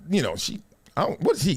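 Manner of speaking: usual speaking voice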